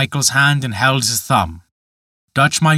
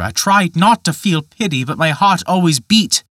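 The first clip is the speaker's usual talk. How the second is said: put-on higher, pleading young voice; a young man pleading his case; Peter crying out; 7 semitones higher, 4 dB louder than usual. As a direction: cry out helplessly.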